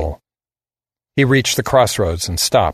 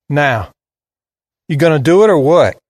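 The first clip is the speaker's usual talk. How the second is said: put-on higher, louder southern kid, defiant; southern drawl; 5 semitones higher, 5 dB louder than usual. A narrator's reading. Boy dares the gunman to do it, defiant southern drawl. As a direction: dare him in a Southern drawl. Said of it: "He had a deep southern drawl".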